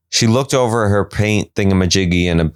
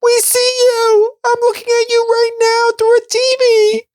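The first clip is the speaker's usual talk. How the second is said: gushing fan. Pretend. Viewer gushes at Jared through the TV, very high mock coo. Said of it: "And I was like".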